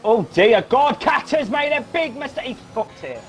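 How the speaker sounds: exasperated cry, pitched high; dismayed, high-pitched outburst; real frustration. Real, not acted. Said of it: "I'm actually really frustrated now".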